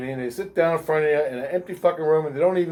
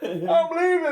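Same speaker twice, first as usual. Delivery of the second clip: mock panic; 12 semitones higher, slower than usual. Scared coworker retold, laughing.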